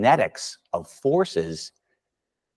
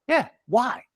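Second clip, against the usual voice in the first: put-on kid's high reply. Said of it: "They go".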